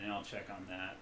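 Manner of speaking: usual speaking voice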